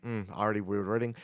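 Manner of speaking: ordinary talk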